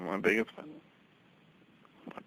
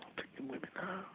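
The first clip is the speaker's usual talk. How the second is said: unvoiced, much softer whisper; 8 semitones higher, 15 dB quieter, faster than usual. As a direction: breathe it as a secret. Repeated line, second whispered.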